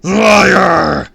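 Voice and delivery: high, long accusing scream. A narrator's reading. Villain rejecting the kid's denial, screaming. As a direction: hurl the word at full volume. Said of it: "he screamed".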